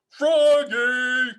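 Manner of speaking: high calling voice, loud; dad calling Froggy from afar. A narrator's reading. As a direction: call the name out across the house. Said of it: "called his father".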